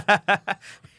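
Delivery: loud high burst of laughter. Real, not acted. Friend laughing at a teasing joke, amused laugh. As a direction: laugh hard at the ribbing.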